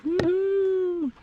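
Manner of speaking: high, bright woohoo cheer; excited cheer. Real, not acted. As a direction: whoop with joy up high. Cheering after climbing up.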